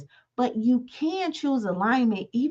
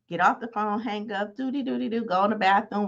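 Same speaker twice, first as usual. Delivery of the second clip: ordinary talk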